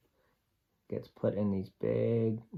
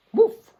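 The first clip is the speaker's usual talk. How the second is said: a high, loud, playful woof; dog bark; 14 dB louder than usual. Acted. Sam the dog signing off to the kids with a high loud woof. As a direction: bark like a happy dog saying goodbye.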